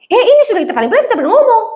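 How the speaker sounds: hammed-up Batak protest; loud Batak reply. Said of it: "memahami mereka berbicara". (logat Batak yang keras)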